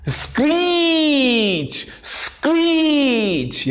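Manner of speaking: high-pitched, long drawn-out "screech"; imitated screech. Shown as a demonstration. Teacher acting out the word's meaning, screeching it high and slow. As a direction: stretch "screech" into a screech, sliding down at the end. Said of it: "यानी चीख".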